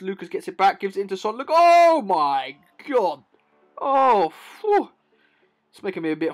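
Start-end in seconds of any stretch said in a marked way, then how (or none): 1.4-3.2 loud, high gasp of amazement; real stunned outburst; excited amazement. Real, not acted. Amazed at goal. 3.8-4.9 loud, high phew; real breathless awe; exhale of awe. Real, not acted. Overwhelmed after goal.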